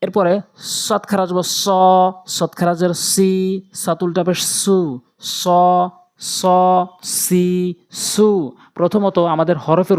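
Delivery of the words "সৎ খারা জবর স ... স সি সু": long held vowels in স, সি, সু; slow recitation drill drawing out স, সি, সু; one-alif elongation. Shown as a demonstration. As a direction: stretch স, সি, সু evenly, each on its own. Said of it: "একটু টান হবে".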